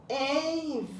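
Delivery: stretched syllables. Shown as a demonstration. Teacher sounding out a word for pupils, "Envelope" in stretched syllables. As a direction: stretch all four syllables of "Envelope" in one run. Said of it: "Separe a sílaba das palavras".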